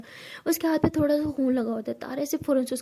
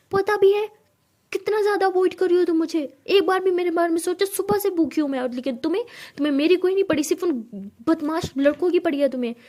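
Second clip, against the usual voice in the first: much higher and louder, hurt and shouting; furious outburst; 6 semitones higher, 6 dB louder than usual. Acted. Tara shouting that Siya ignores her. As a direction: unload every grievance at once.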